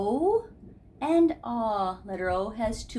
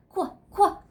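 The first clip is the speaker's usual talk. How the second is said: monkey chatter; 11 semitones higher, 7 dB louder, slower than usual. Acted. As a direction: chatter then squeal like a monkey.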